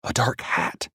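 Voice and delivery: frozen with fear, whispered; terrified whisper. A narrator's reading. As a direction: breathe it out, too scared to speak. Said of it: "paralyzed with fear".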